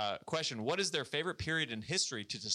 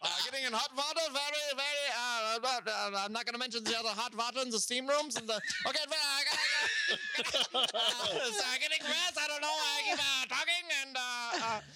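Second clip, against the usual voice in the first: laughing; 12 semitones higher than usual. Real, not acted. Laughing through a Nazi riff.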